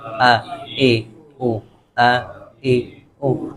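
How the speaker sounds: آ اے او sounded slowly from the throat; alif sound demo. Shown as a demonstration. Teacher modelling alif vowels for students, slow. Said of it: "گلے کے اوپر والے حصے سے".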